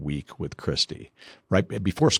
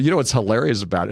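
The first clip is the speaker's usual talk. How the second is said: normal talking voice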